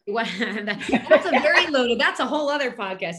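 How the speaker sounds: laughing. Real, not acted. Laughs at host's big question.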